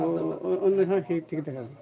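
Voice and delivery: ordinary talk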